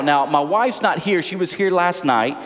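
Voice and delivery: ordinary talk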